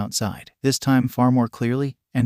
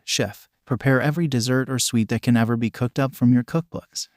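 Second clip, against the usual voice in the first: usual speaking voice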